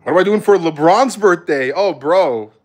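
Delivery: ordinary delivery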